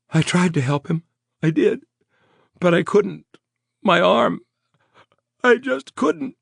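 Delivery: high, loud breaking plea; hysterical. A narrator's reading. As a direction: sob out the failure. Traumatized woman repeating.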